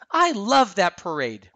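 slow, loud, high-pitched delight; high, happy exclamation, lingering on the words; gushing fondness. A narrator's reading. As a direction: gush with drawn-out enthusiasm. Anna gushing about childhood parade.

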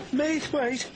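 high startled question; a worried little engine caught off guard; surprised question. Acted. Percy asking Sir Topham in high alarm.

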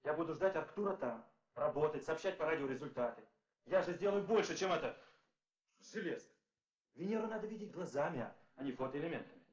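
passionate plea. Acted. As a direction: argue your case with fire. Pleading to go himself.

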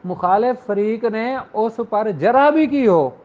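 ordinary delivery

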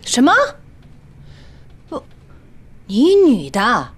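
loud, rising disbelief; a burst of alarm at the idea; loud, high-pitched dismay; shocked outburst. Real, not acted. Shocked at night-market job.

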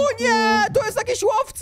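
high, loud play panic; panicked cry. Pretend. Wolf spotting a hunter in the game, panicked. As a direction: cry out in mock terror.

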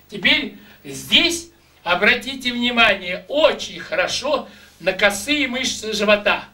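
ordinary talk, nothing marked

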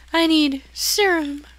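high, loud, slow hammy plea; mock overacted character whining for serum; bad actress impression. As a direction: ham it up like a bad game actress. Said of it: "Swear I'm a better actor than her".

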